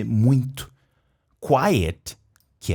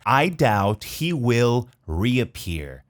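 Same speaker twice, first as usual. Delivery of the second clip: "I doubt he will reappear" spoken very slowly, "doubt" with no B; very slow demo; much slower than usual. Shown as a demonstration. Teacher slowing the sentence for students. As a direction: drag each word out for learners. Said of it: "A gente não pronuncia esse B".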